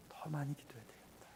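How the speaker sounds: unvoiced whisper leaning on 많이, falling; whispered resolve. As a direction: hush it, weight on 많이. Repeated inner resolve, whispered.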